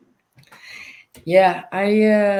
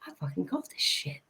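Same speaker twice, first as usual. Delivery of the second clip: excited stage whisper; 10 dB quieter, faster than usual. Retold or imitated. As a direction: hiss it like a secret triumph. Retold thrilled aside at concert.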